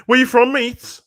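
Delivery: high, loud mock cockney thug asking where you're from; cockney hooligan. Retelling a football factory scene, mock cockney thug voice. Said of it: "on a football factory thing".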